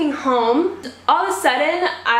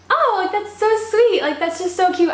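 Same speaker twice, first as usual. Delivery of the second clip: flattered gush; 9 semitones higher than usual. Retold or imitated. Flattered by coffee invitation.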